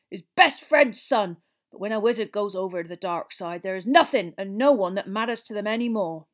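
loud, aggrieved giant's storytelling; gruff-spoken Hagrid, indignant. Acted. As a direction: tell it loudly, with rising outrage.

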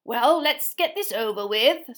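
slow, impatient reedy official; a reedy committee member, eager to finish. A narrator's reading. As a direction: drag the words out, wanting it done. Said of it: "said the reedy voice".

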